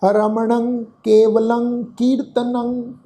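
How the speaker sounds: slow, measured Gurbani recitation; scripture recitation. Shown as a demonstration. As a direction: draw out each word of the verse. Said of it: "ਇਸ ਦਾ ਉਚਾਰਨ ਕਰਦੇ ਹਾਂ".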